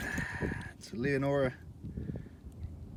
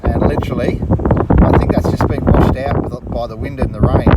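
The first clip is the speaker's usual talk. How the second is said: raised voice against strong wind; shouting over wind; 13 dB louder than usual. Real, not acted. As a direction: push the voice out over gusts. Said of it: "I hope you can hear me through this wind".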